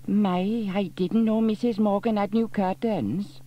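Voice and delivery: high, loud nosy busybody; clucking gossip. A narrator's reading. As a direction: delight in spying on neighbours. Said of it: "clucked Dilys".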